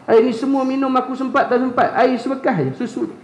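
high-pitched fretting, lightly voiced; acted-out worry. Preacher voices Abu Hurairah fretting over one cup of milk, mock worried. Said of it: "Sayyidina Abu Hurairah dah risau dah".

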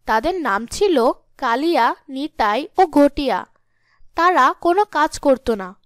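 ordinary delivery, nothing marked

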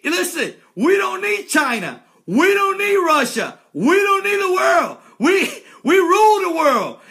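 chest-thumping rallying cry; building patriotic shout. Real, not acted. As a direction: crank it up to a proud shout.

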